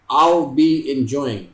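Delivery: slow drawn-out contracted I'll. Shown as a demonstration. Teacher shows students to say I'll be enjoying slowly. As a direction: say I'll as one blended sound, slowly. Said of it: "आपने ऐसे बोलना है".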